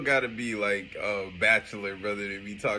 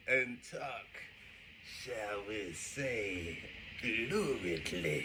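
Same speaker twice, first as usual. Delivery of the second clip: slow, softer, mock-dainty "shall we say, luridly"; mock refined delivery; 7 dB quieter, much slower than usual. Co-host mocking the host's fancy word, slow and soft. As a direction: savour each word like a prissy quote.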